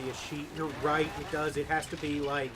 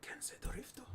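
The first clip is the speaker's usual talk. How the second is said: unvoiced whispered question; 14 dB quieter than usual. Real, not acted. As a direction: breathe it as a whisper. Muttered aside to himself.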